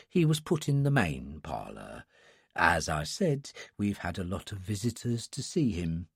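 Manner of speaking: put-on soft, hushed old attendant; a sleepy old man whispering politely; quiet old man voice.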